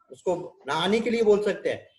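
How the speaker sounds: ordinary talk, nothing marked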